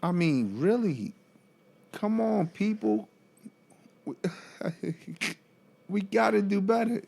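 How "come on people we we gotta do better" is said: high appeal to everyone; exasperated plea to do better; pleading. Real, not acted. Appeal to caregivers.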